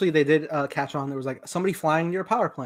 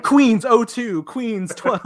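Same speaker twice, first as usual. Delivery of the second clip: slow, laughing announcer read; title card announcer; 7 semitones higher, 7 dB louder, much slower than usual. Pretend. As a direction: read it like a slow on-screen caption. Mock title card reading.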